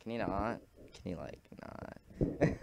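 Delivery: very soft, muted mock plea; hushed objection. Friend in the corner to the noisy student, hushed objection. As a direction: murmur it, slow and unimpressed.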